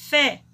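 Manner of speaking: FÉ punched loud on its own; tonic syllable stressed. Shown as a demonstration. Teacher confirming the tonic syllable, loud stressed FÉ. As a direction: hit "fé" as one strong syllable. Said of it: "aí é a sílaba tônica".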